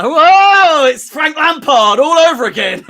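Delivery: loud, high mock-astonished reveal; Scooby-Doo meme unmasking. Fan acting out a manager's post-match meme reveal, hammed-up astonishment. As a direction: draw out the whoa in exaggerated surprise. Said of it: "like the old Scooby-Doo meme".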